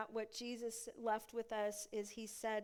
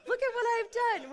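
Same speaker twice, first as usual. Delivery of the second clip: much higher and louder, laughing through it; laughing mock brag; 13 semitones higher, 12 dB louder than usual. Preacher repeating the imagined brag, laughing mock boast. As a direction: crow it with a laugh. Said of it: "just saying".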